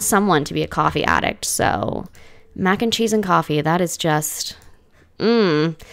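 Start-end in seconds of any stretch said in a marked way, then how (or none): none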